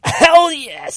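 very high, loud squeal; gleeful yes. Acted. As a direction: shout it with delight. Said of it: "says Gabby".

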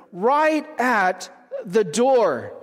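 each word of "right at the door" drawn out slowly; slow emphasis. Shown as a demonstration. Preacher stressing to the congregation, slow "right at the door". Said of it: "it repeats this emphasis".

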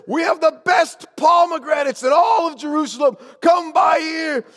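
high, loud market cry; vendor's shout. Acted. Seller hawking pomegranates to the caravan, calling out. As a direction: shout to draw buyers in, stretching the last call.

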